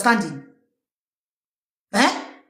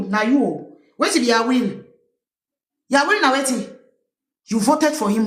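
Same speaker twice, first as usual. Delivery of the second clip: ordinary talk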